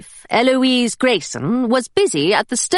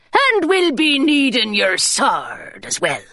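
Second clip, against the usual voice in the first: gruff pirate; slower than usual. Acted. Twiggett speaking.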